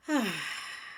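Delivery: long falling sigh; calming sigh. Acted. Speaker catching herself mid-tone, a calming sigh. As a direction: let out a slow, soft breath.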